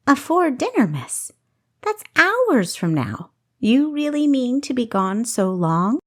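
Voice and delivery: a housekeeper gently questioning her mistress; shrewd old maid. A narrator's reading. Maid Delphinia questions Kessa.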